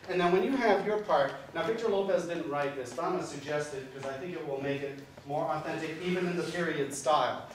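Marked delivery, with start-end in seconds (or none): none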